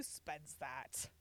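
whispered mock outrage; scoffing whisper. Real, not acted. Whispering scorn about the boss's pricey booze. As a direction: hush it, scoffing.

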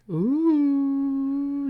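long high "ooh", held steady; high drawn-out ooh. Pretend. Co-host leads into a crazy sport, high drawn-out ooh. Teasing reaction before introducing the sport.

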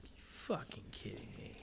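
very soft, voiceless mutter; retold whispery aside; whispered disbelief. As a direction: say it under your breath, exasperated. Repeats exasperated line under breath.

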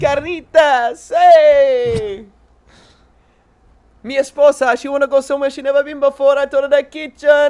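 hammed-up Spanish accent. Cheesy comic telling a podcast host his jokes, in a hammed-up Spanish accent.

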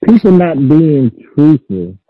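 sounds muffled, like through a wall. Real, not acted. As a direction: talk from under the covers, muffled. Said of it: "'Cause you sound muffled".

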